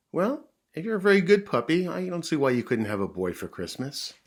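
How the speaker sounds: high, loud opening easing down; mother dog, encouraging. Acted. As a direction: reassure the puppy in a lifted mother's voice.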